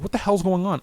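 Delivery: high-pitched mock outrage; indignant bafflement. Will finding the presenting center empty, indignant. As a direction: protest it high, in disbelief. Finds nobody there.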